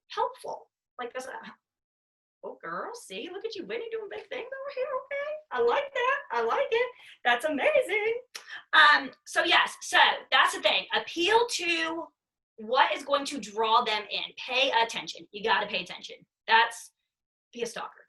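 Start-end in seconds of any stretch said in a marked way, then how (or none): 2.4-8.1 higher, softer, gushing praise voice; gushing cheerleader. Pretend. To a teammate doing big things, high soft gushing praise. 17.5-18.0 whispered aside. Real, not acted. A joking aside to viewers.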